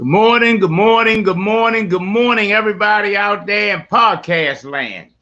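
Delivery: high, loud stretched greeting; big show opener. Acted. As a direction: belt it out like a morning DJ. Radio-style show opener.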